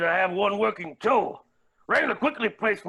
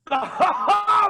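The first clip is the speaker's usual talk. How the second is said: loud high cackle; maniacal laugh; 10 semitones higher, 5 dB louder than usual. Acted. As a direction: burst into a wild laugh. Smells gunpowder, revived.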